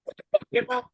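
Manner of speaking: high, comic impression of a squad leader; irritated corporal. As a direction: snap at him like an annoyed corporal. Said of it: "the corporal's like".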